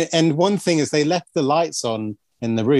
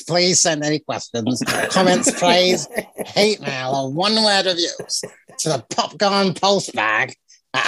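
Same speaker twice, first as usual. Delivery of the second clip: put-on hillbilly accent; 2 semitones higher than usual. Outro asking listeners for mail, mock hillbilly accent. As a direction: say it all in a mock hillbilly twang. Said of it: "this is my hillbilly accent".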